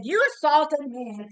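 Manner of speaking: high, accusing cry; replaying the moment she confronted the bodyguard, indignant and high; retold accusation. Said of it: "I said".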